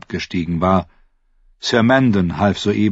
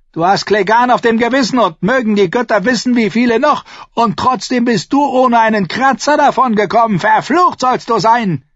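high, raised, relentless scolding; furious tirade; 5 dB louder than usual. A narrator's reading. Uncle blaming his nephew for the deaths, furious. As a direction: tear into him in a sustained rage. Dwarf berates the fallen king.

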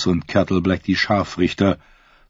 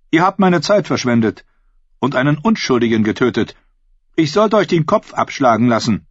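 angry queen; 6 semitones higher than usual. A narrator's reading. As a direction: threaten them like a furious queen. Queen scolding.

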